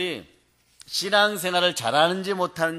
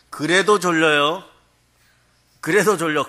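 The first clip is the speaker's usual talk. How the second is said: hammed-up groggy insistence; mock drowsy protest; 7 dB louder than usual. Preacher voicing sleepy commuter loudly.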